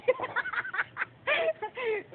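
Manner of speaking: high-pitched peals of laughter; laughing fit. Real, not acted. Battler breaking up mid-routine, high laughter.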